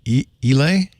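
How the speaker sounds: Islay said as "ee-lay", questioning; tentative "ee-lay" for Islay; questioning mispronunciation. Shown as a demonstration. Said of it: "you pronounce I S L A Y".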